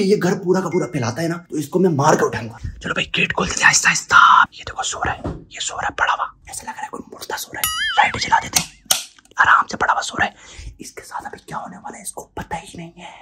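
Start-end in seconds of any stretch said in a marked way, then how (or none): 2.8-13.1 hushed whisper so he won't wake; sneaky whisper over a sleeping man. Acted. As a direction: breathe the words, no voice at all.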